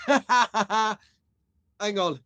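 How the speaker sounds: laughing. Real, not acted. Laughs mid-sentence at viewers.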